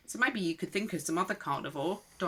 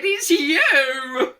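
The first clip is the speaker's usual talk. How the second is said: high, loud, gleeful answer; amused reveal; 6 semitones higher, 11 dB louder than usual. A narrator's reading. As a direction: say it as a merry reveal. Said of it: "they laughed and said".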